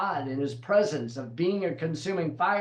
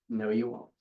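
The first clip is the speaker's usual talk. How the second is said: Jesus's soft reply; 5 semitones lower, 5 dB quieter than usual. Acted. Jesus answers Peter softly.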